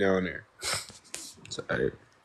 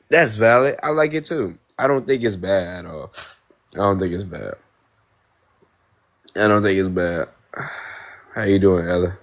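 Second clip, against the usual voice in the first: usual speaking voice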